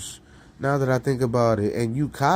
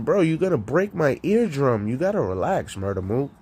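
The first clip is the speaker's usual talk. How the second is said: normal talking voice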